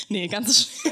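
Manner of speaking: words broken by laughter; laughing. Real, not acted. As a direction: laugh through the denial. Laughs off her own joke.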